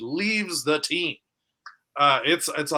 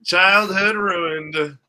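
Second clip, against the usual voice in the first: very slow, drawn-out lament; slow mock lament; 5 semitones higher, 6 dB louder, much slower than usual. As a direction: stretch it out in mock grief. Repeats the joke.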